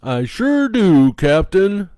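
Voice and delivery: high, loud, slow puppet voice; happy clock puppet. Acted. Clock puppet answering Captain Kangaroo, high loud puppet voice. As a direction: announce it like a cheery old puppet. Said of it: "the happy old grandfather clock puppet announces".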